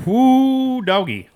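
loud high whoop; excited whoop. Real, not acted. Tasting the stout, a loud high whoop. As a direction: let out a big delighted whoo. Reacting to the beer taste.

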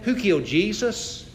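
usual speaking voice

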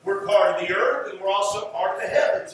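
normal talking voice

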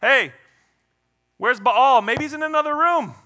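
loud, high-pitched mock calling out; needling, sing-out taunt at opponents; trash talking. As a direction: taunt the rival side like trash talk. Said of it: "he's trash talking them".

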